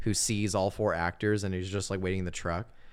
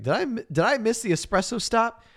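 puzzled mock voice; 13 semitones higher, 3 dB louder than usual. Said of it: "he's like".

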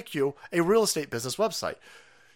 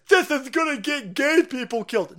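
mock scream; 8 semitones higher, 7 dB louder than usual. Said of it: "The left-hand side is screaming".

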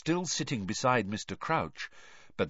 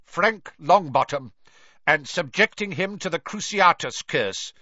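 loud stern; 6 semitones higher, 5 dB louder than usual. A narrator's reading. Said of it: "speaking more loudly".

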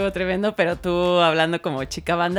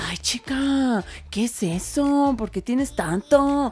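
put-on light Sinaloa accent, slowed and drawn out; a playful take on a Sinaloa influencer's accent; imitating Sinaloa influencer; 3 semitones higher, 4 dB quieter, much slower than usual. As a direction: stretch the words like a cheerful Sinaloa influencer. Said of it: "como de una manera muy simpática".

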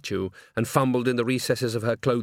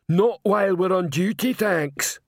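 higher troll voice, earnest refusal; dim troll; 8 semitones higher, 3 dB louder, slower than usual. A narrator's reading. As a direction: blurt it as an oblivious troll on duty. Troll blurts out cover.

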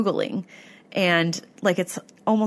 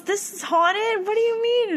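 amazed question; 14 semitones higher than usual. Retold or imitated. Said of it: "she was getting so excited".

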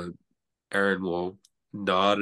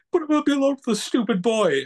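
much higher, fast, loud whine; whiny imitation; 6 dB louder, faster than usual. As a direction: mimic her whining, hammed up. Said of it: "like, when you say".

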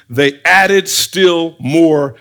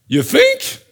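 high squeaked mock question; sarcastic incredulity. Real, not acted. Preacher remarking on the obvious hatred, sarcastic high squeak. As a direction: leap up high in mock disbelief.

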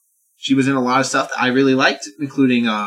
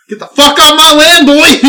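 loud, high shotgun-granny holler; imitated angry yell; 13 semitones higher, 11 dB louder, slower than usual. Old lady with shotgun chasing off preacher, mock furious yell. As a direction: holler it like an old lady defending her land.

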